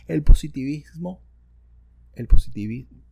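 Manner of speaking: booming into a sensitive mic; too loud. Real, not acted. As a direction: announce the topic loudly into the microphone. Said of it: "estoy gritando mucho".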